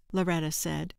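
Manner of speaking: ordinary delivery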